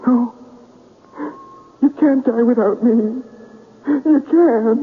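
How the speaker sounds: pleading against fate; desperate. Acted. Man desperate over her death.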